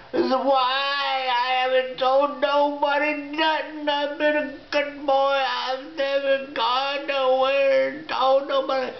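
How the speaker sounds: a tearful, shouted plea of innocence; crying plea. Retold or imitated. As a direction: beg them through tears that you told no one. Cries and pleads his innocence.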